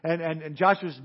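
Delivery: ordinary talk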